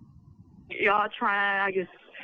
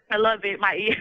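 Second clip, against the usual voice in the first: usual speaking voice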